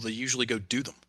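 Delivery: usual speaking voice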